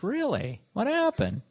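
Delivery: high-pitched, mock-startled questions; surprised reaction, acted out; retold surprise. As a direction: re-enact taken-aback curiosity, pitch jumping up. Re-enacts his reaction to phone call.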